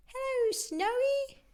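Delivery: very high, bright little owl greeting. Acted. Classmate owl greeting Al in a very high voice. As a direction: greet the new boy in a tiny high voice.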